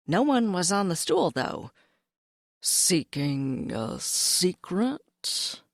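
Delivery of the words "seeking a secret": slow, low, secretive cat; sly cat voice. Acted. Cat character voice.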